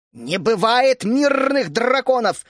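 loud, high, slow furious denial; angry retort. A narrator's reading. Teresa towering over Vishnya, furious denial. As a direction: loom over her and bark it. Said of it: "нависла над ней Тереза".

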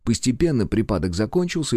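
usual speaking voice

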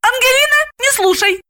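very high, fussy order; commanding. A narrator's reading. Galina orders her baby not to listen, high command. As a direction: order the child, pitched high. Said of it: "и приказала".

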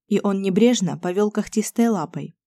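plain reading voice

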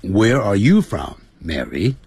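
American English with R's sounded; American accent. Shown as a demonstration. Teacher demonstrates the American question to Mary, American accent. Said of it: "在美式英语就是".

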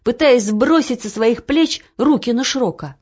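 ordinary delivery

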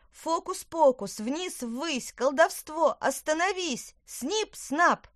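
high, loud incantation in bursts; shouted spell. A narrator's reading. Witch stops her spell with wand, shouted incantation. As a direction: cry out magic commands. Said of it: "и крикнула".

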